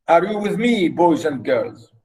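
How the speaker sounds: ordinary talk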